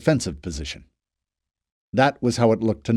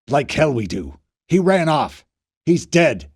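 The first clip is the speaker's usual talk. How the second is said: harsh dismissal; 3 dB louder than usual. A narrator's reading. Fisher rejecting the plea.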